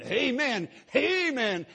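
high, loud answering amens; mock excited crowd calling amen; congregation amen. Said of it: "everybody in the audience going".

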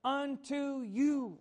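"Unto you" high and slow, "you" stretched; declared emphasis. Shown as a demonstration. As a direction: proclaim "Unto you" slowly. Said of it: "right now we can declare".

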